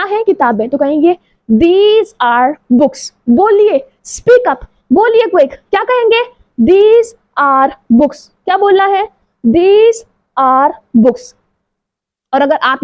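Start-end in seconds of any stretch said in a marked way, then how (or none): 1.5-3.1 very slow and clear; slow model sentence. Shown as a demonstration. Teacher modeling for pupils, very slow. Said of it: "model sentence for class". 6.7-11.4 very slow and clear; slow model sentence. Shown as a demonstration. Teacher modeling for pupils, very slow. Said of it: "repeated model sentence".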